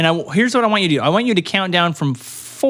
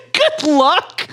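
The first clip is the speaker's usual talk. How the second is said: laughing; 3 dB louder than usual. Real, not acted. Echoing partner's line while laughing.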